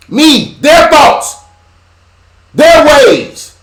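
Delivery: high, loud preaching cry; preaching at full force. Acted. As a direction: hammer each phrase home. Preaching climax about the media.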